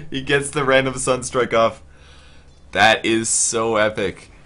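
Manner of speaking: slow, loud amazed call; excited awe. Real, not acted. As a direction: savour the epic play. Amazement at play.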